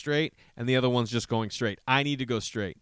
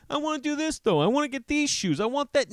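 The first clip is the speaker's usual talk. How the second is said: put-on much higher whiny kid voice; mock little girl; 13 semitones higher than usual. Dad imitating his little daughter before dance class, high whine. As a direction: play the daughter who wants shoes.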